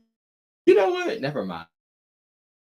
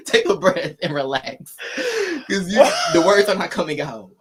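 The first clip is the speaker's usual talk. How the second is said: laughing; 3 semitones higher, 6 dB louder than usual. Retold or imitated. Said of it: "I'm like".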